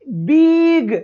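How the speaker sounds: बिग with a long stretched ee, "beeeg"; drawn-out vowel. Shown as a demonstration. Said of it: "ऐसे थोड़ी बोलते हैं".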